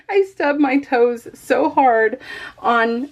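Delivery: laughs as she speaks, slowed down; real giggles breaking up the words; laughing through it. Real, not acted. To her viewers about the toe stub, laughing.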